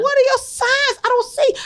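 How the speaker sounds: high-pitched, puzzled mother; retold bafflement. Retold baffled question to her son.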